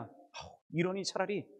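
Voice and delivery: soft, sighing resignation; giving up. Acted. Preacher voices a hurt believer quitting, resigned. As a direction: breathe out a sigh and drop the whole thing. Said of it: "심지어 어떻게 되느냐".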